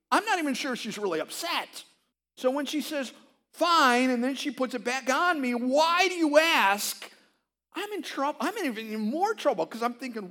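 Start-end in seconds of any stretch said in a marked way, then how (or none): 3.5-4.1 loud, high mock "Fine"; a pastor mimicking his wife's clipped answer, loud and high. Wife's reply quoted loudly. 5.6-7.1 slow, high, loud mock retort; a pastor voicing his wife's pointed question, slow and loud; mimicking wife.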